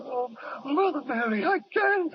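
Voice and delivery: high, cracking wail; desperate plea. Acted. Deserter begging the lieutenant, high desperate wail. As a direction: beg as if your life depends on it.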